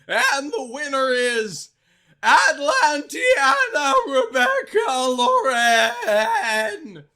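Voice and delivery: high, loud, slow announcement; ecstatic scream. Acted. Principal screaming winner's name to the crowd. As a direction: stretch out the winner's name with glee. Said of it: "The principal screamed ecstatically".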